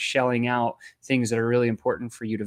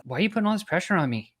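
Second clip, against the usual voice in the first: with tonality; 6 semitones higher, faster than usual. Shown as a demonstration. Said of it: "you can say it with tonality".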